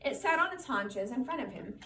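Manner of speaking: plain reading voice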